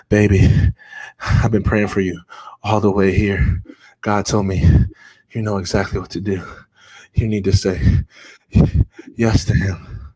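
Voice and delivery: imitating a winded grandmother, gasping between phrases; out of breath. Grandma who ran through the hospital to her grandson, out of breath. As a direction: pant out each short phrase, acted. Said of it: "She's out of breath".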